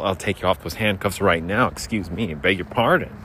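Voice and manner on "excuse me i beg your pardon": normal talking voice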